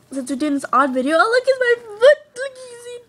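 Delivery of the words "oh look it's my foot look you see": high, loud silly squeals; playful excitement. Real, not acted. Girl shows her foot to the camera, playfully excited. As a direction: act goofy and thrilled about a foot.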